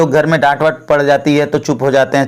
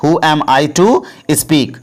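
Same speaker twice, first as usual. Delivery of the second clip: very slow, every word laid out for learners; slow, careful model sentence; slow teaching demo; much slower than usual. Shown as a demonstration. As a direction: spell it out slowly for the class. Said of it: "ठीक है, तो".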